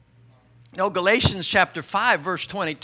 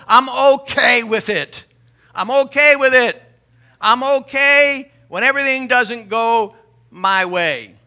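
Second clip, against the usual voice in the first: loud, ringing preacher declaration; full-force preaching; 4 semitones higher, 11 dB louder than usual. Acted. Preacher declaring his faith to the church, loud. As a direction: proclaim it to the congregation.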